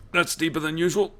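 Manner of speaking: high, startled voice on the sudden discovery; startled realisation. Retold or imitated. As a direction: blurt it out in shock at the cut. Sudden realisation of a deep cut.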